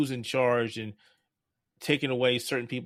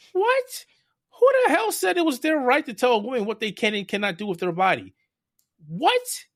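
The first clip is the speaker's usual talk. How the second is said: high, loud, incredulous outburst; outraged disbelief; 13 semitones higher, 6 dB louder than usual. Real, not acted. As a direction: let the indignation explode. Outrage at Supreme Court ruling.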